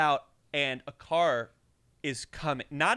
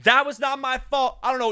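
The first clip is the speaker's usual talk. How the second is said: loud, higher protest; defensive driver; 6 semitones higher, 6 dB louder than usual. Retold or imitated. Driver walking over, a loud protest. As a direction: push back hard and high. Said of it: "and goes".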